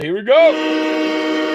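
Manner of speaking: slow, high, loud here we go; drawn-out hype call. Real, not acted. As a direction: stretch it like an announcer. Announcing the super chat.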